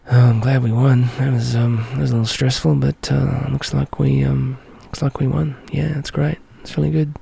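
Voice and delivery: low, subdued mock celebration with halting pauses; underwhelmed fan. Fan reacting to a big final win, underwhelmed. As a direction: imitate a deadpan, barely bothered winner. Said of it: "you don't tend to find yourself sitting there going".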